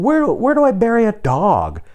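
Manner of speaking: high-pitched bewildered outburst; bewildered question. Retold or imitated. Himself looking around for a burial spot, high bewildered plea. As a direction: ask it high and at a loss. Acted bewildered reply to neighbor.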